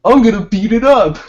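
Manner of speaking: high and loud boast; mock tough kid. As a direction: play the kid picking up a stick. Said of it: "he's like".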